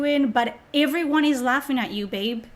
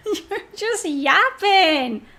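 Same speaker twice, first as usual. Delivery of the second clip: laughing through it, slow; mocking laughter over the words; laughing put-down; 8 semitones higher, 3 dB louder, much slower than usual. Real, not acted. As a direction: laugh at him as you say it. Repeats it laughing.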